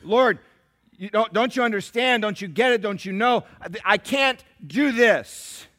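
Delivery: loud, stammering plea that slows at the end; loud pleading tirade. Acted. Moses protesting to God, a loud pleading tirade. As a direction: beg God to understand, in full voice. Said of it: "this tirade of just saying".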